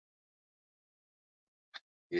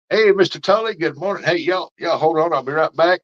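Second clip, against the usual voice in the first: louder, higher call across the yard; 8 semitones higher, 7 dB louder than usual. Real, not acted. Greeting neighbour Mister Tully across the yard, calling out. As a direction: call out a cheery good morning.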